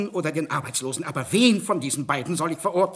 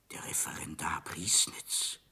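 softer, slow whispered report; 11 semitones lower, 11 dB quieter, slower than usual. Acted. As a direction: murmur the name under your breath. Servant reports the caller softly.

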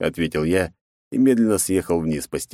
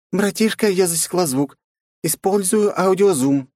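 put-on much higher young sister voice; Mai, little sister; 12 semitones higher than usual. A narrator's reading. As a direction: report eagerly to big brother. Mai on radio.

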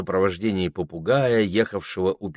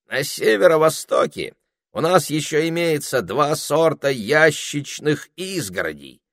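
parrot voice; 8 semitones higher, 4 dB louder than usual. A narrator's reading. Parrot continues.

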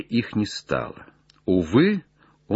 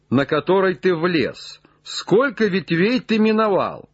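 raised, high-pitched instructions; calling up; 7 semitones higher than usual. Acted. Legrand instructs Jupiter up the tree, raised calling voice. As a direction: call orders to someone high above.

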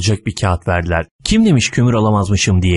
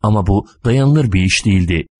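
usual speaking voice

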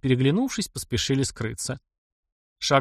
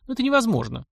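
higher, understated wistful voice; unexpected sadness; 11 semitones higher, faster than usual. A narrator's reading. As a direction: end with a touch of sorrow. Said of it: "закончила она с неожиданной грустью".

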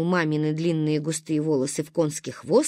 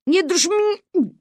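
strained repeat; 11 semitones higher, 4 dB louder than usual. Acted. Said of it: "говорю".

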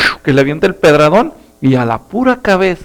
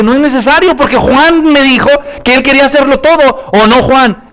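put-on high, louder girl's voice; sly little girl; 7 semitones higher, 4 dB louder than usual. Laura volunteering Juan again, high sly voice. Laura repeats her trick.

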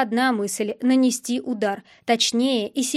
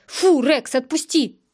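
firm, raised-voice dog command; 3 semitones higher, 4 dB louder, slower than usual. A narrator's reading. Hero pulling the dog off Sizov, firm command. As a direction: order the dog to let go. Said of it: "скомандовал я".

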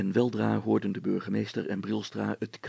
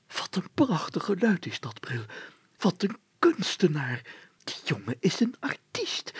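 excited hushed whisper, higher; rapt whisper; 8 semitones higher than usual. A narrator's reading. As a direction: marvel under your breath. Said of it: "fluisterde de burgemeester verheerlijkt". (opgetogen gefluister)

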